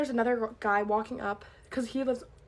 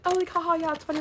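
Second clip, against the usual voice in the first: higher, forced "haha" playing along; feigned amusement; 5 semitones higher, 3 dB louder than usual. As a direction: pretend to find it funny, mildly acted. Acting along with the joke back then.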